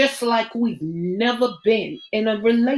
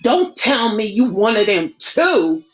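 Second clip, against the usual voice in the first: sharp, louder snap; louder, accusing snap; snapped; 3 semitones higher, 7 dB louder than usual. Acted. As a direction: bite the line off at her. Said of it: "he snapped".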